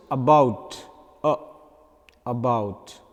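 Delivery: about with a brief uh at the start; short unstressed uh in "about"; short first vowel. Shown as a demonstration. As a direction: keep the a of about short and light. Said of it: "Then a short is".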